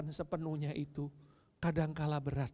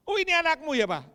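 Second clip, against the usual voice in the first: mocking church member; 11 semitones higher, 10 dB louder, faster than usual. Congregant mocks the daughter.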